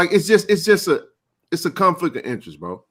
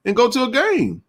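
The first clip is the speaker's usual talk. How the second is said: excited; 10 semitones higher than usual. Real, not acted. Excited high argument.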